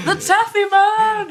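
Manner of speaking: high, loud, drawn-out announcement; hammed-up fanfare. Co-host hails the boss, high hammed-up cheer. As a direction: belt it out high and slow.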